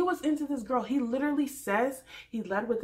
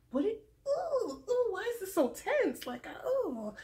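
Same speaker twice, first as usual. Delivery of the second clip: high squeaky cringe voice; cringing; 12 semitones higher than usual. Retold or imitated. Cringing at an awkward TV moment, high and squeaky. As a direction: wince through it, voice up high. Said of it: "I was literally cringing".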